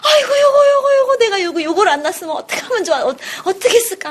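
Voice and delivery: very high, loud, gushing mother; mother doting on her smiling baby, high and laughing; adoring mother. Acted. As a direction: coo over the baby, laughing and fast.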